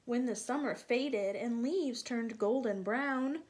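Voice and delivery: animated storybook reading; reading to children. A narrator's reading. Teacher reading aloud to children. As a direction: read it lively for little ones.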